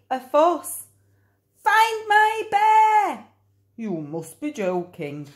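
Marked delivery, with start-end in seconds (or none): 1.6-3.2 high, loud, slow cry; boy insists on his bear; very high pleading shout; demanding shout. Acted. 3.7-5.3 grumpy man. Acted. Dump man replies.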